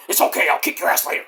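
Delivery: fast, whispered devil threat; a quick hushed threat from Satan. Acted. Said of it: "that's satire".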